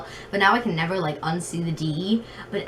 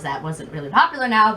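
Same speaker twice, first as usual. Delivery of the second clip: usual speaking voice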